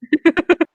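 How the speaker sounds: sudden high laugh; burst of laughter. Real, not acted. Cohost laughing hard at her partner's joke. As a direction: crack up at the joke.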